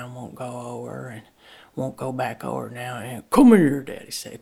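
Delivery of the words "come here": calling out. A narrator's reading. Daddy calls Lucy.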